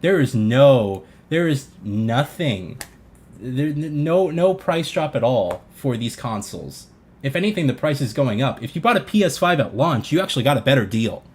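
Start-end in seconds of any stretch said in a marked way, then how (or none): none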